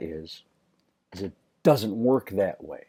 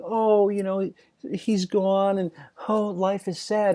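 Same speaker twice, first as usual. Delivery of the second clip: mock mourning; 9 semitones higher than usual. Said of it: "we weren't saying".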